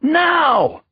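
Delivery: high, loud furious scream. A narrator's reading. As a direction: scream the order in rage. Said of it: "Tut screamed".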